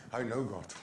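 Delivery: low, soft "I know God"; reply quietly, dropping away after "know"; hushed, matter-of-fact imitated reply; assured answer. Acts the grandchild's assured answer.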